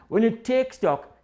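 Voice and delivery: ordinary talk, nothing marked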